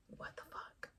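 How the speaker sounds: breathless whispered disbelief. Retold or imitated. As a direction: whisper it, stunned. Answer to the endless whys.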